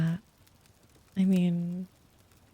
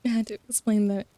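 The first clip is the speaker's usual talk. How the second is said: ordinary delivery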